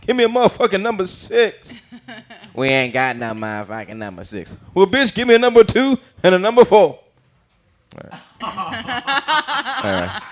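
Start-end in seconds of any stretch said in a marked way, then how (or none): 0.1-1.7 high-pitched comedian impression; Bernie Mac. Customer orders at McDonald's, high brash impression. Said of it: "Bernie Mac going to McDonald's and ordering". 2.5-4.6 fast-food clerk. Acted. Clerk tells customer it's unavailable, understated reply. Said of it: "they don't have what he wants". 4.7-7.0 loud, high comedian impression; Bernie Mac. Comic orders at McDonald's, loud high impression. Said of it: "they don't have what he wants".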